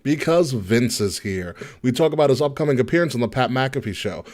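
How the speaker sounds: upbeat announcer promo; podcast promo read. Acted. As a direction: sell the episode like a radio host. Reads show promo.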